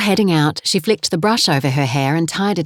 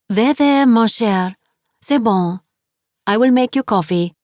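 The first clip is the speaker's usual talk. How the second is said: higher, gentle French landlady comforting; soothing French accent; 5 semitones higher than usual. Acted. Landlady comforting tearful guest, gentle French accent. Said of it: "her accent was heavy".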